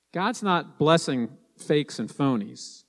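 ordinary talk

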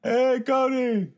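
slow squeaky clown greeting; silly clown. Acted. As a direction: stretch it out high and goofy.

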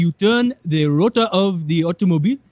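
mock Nigerian accent, earnest insistence; Nigerian pilot impression. Man showing off his homemade helicopter to a reporter, Nigerian accent. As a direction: imitate a proud amateur pilot, Nigerian-accented. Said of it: "the guy himself doesn't even talk much".

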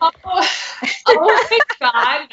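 high, loud delighted laugh; thrilled laugh. Real, not acted. Listener reacting to the scene, thrilled laughing. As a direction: gush with excitement.

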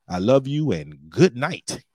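normal talking voice

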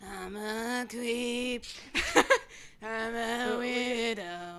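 slow, drawn-out creep voice; creepy voice. Pretend. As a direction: drag it out like a weirdo. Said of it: "you sound just like them".

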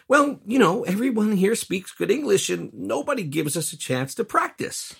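high-pitched, defensive excuse; mock defensive. Expat excusing themselves to overseas relatives, defensively. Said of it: "what we've been defensively telling our overseas relatives".

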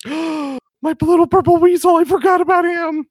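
high, loud startled cry; shocked gasp. Real, not acted. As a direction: blurt it out in sudden alarm. Player shocked, remembers weasel.

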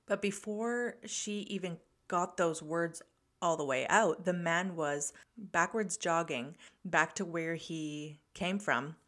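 ordinary talk, nothing marked

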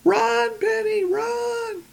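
high mock shout, slowing down; yelling at the screen. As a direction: shout warnings at the TV. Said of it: "And we're all going".

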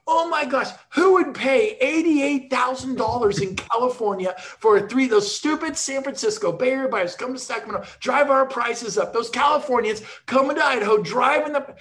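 loud, high indignant rant, imitating the neighbors; mock angry neighbors. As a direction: ham up the outraged neighbor, shouting. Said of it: "neighbors come over all mad".

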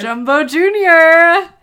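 loud, slow alien voice. Pretend. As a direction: boom the title like an alien. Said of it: "you can do the alien".

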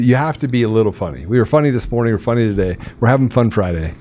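normal talking voice

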